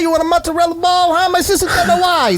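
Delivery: high, fast cartoon New York accent; hammy Brooklyn cartoon sidekick; fake New York accent. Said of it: "Joey Wheeler is obvi".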